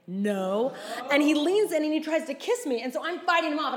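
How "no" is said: ordinary talk, nothing marked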